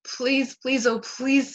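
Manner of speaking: groaned plea, drawn from each please; pleading groan. A narrator's reading. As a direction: plead like a kid desperate to be picked. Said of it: "Sam groaned".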